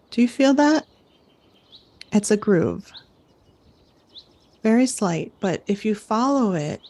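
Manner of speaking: camp counselor guiding an imagined climb; guided visualization. Acted. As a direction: lead the campers up the block, coaxing.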